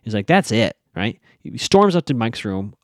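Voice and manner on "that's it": ordinary talk, nothing marked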